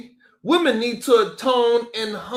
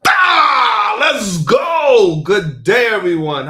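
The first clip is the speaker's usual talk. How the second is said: loud, high hype shout to open the stream; hyped opener; 4 semitones higher, 9 dB louder, slower than usual. Real, not acted. Host opening his stream to the chat, loud hype shout. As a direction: fire up the live chat.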